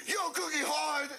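high, slow, indignant mimicry; mock outraged complaint; acted complaint. Retold cookie complaint, acted out.

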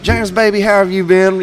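high, affectionate greeting; lightly voiced bar owner greeting him; a hint of bar owner. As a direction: gush a little over an old friend. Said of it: "she goes".

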